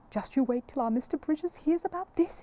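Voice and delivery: can hardly contain the news; excited exclaim. Acted. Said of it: "exclaimed the first man".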